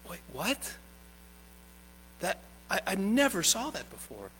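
soft, baffled murmur of the scribes; hushed surprised scribes. Retold or imitated. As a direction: mutter it like a stunned scribe. Scribes imagined reacting.

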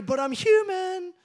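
high, drawn-out mock protest; whiny excuse. Person arguing back with an excuse, exaggerated whine. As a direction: stretch the last word up high. Mimics someone making excuses.

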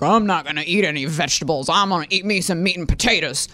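fast, lightly voiced stubborn guy; men who won't eat vegetables, lightly voiced and gruff; stubborn meat-and-potatoes guy. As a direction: brush off the vegetables like a stubborn man. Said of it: "men who typically are like".

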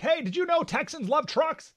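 high-pitched mock amazement; mock reporting back. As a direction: imitate an astonished reporter breaking trivial news, voice way up. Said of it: "they're reporting back to people in New York".

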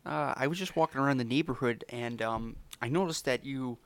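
put-on friendly neighbour with a mild Canadian accent. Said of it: "You sound like you're from Canada".

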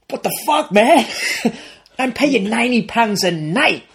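high, exasperated phone rant; hammed-up indignant complaint; mock irate outburst. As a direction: play an outraged man paying the bills. Imitating irate financier on the phone.

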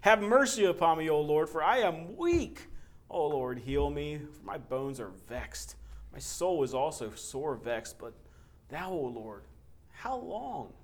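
fervent scripture reading. Acted. Pastor reading Psalm to congregation, loud fervent reading. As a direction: cry it out like a desperate psalmist. Reads Psalm six aloud.

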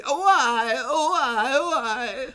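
high-pitched, hammy lament; over-the-top movie wail. Retold or imitated. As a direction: wail it as a ridiculously overacted line. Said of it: "that's actually a line he has in the movie".

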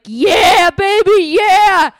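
high, loud party holler; whooping cheer. Retold or imitated. Driver blasting music at drunk friend, gleeful yelling. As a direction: crow it out over blasting music.

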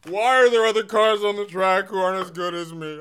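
high, loud whiny complaint; whining Kyle Busch. Retold or imitated. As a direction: whine like a spoiled racer. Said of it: "I was just whining as Kyle Busch".

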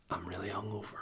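barely audible, whispered confession; hungover whisper. Real, not acted. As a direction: whisper it weakly, as if badly hungover. Comic confession after long pauses.

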